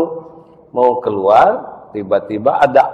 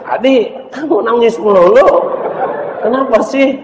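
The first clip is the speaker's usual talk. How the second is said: put-on higher, louder child teasing; high teasing kid; 13 semitones higher, 7 dB louder than usual. Friend teases Adi for crying in the car, high.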